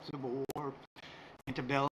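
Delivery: ordinary talk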